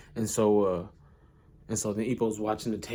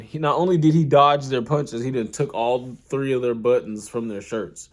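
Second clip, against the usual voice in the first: ordinary delivery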